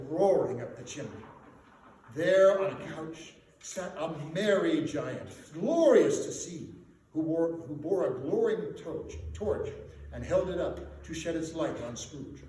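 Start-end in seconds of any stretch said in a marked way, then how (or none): none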